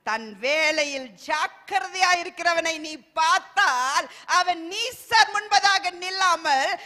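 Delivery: loud, slow, ringing sermon delivery; full-force preaching. Real, not acted. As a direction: preach it to the back row.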